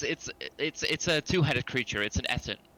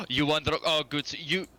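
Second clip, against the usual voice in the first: troll voice; 4 semitones higher than usual. Acted.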